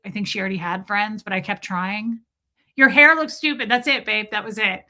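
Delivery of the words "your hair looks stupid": loud quote. Retold or imitated. Shouting out the movie line.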